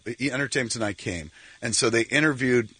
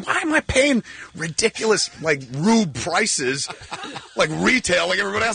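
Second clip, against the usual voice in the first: mock exasperated rant; 9 semitones higher than usual. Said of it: "I was like".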